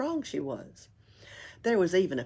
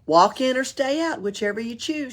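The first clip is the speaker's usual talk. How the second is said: loud call toward the door; a loud cheerful shout through the door; 7 semitones higher, 6 dB louder than usual. Acted. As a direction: call out to the knock. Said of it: "shouted Pippi".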